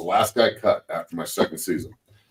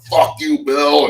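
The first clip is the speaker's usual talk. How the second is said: louder, higher retold yell; a player yelling back at his coach; mock yell; 7 semitones higher, 7 dB louder, much slower than usual. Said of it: "I'm like".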